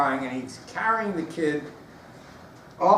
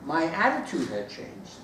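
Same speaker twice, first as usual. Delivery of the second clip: usual speaking voice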